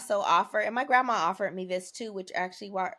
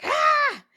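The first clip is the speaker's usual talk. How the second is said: loud, high mock wail; one piercing held bawl; spiteful fake crying; 8 dB louder than usual. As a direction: imitate a kid's put-on cry. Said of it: "You know, kinda crying".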